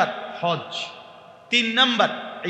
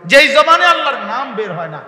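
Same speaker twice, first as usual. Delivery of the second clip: loud, rising sermon rebuke; forceful reproach from the pulpit; impassioned preaching; 4 semitones higher, 7 dB louder than usual. Real, not acted. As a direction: preach it hard, scolding the congregation.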